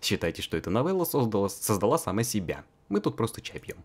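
ordinary talk, nothing marked

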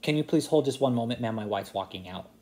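usual speaking voice

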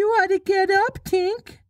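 put-on a high, boyish call; calling Nibs. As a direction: call up to the fairy as young Nibs. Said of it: "Nibs called".

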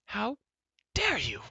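high, spaced-out indignation; outraged. A narrator's reading. Girl affronted by Taryn's threat, outraged. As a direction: break it in two for outrage.

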